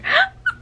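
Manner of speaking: short, loud, high gasp; high shocked gasp. Real, not acted. Reader reacts to the kiss, gasping. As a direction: gasp sharply with delight.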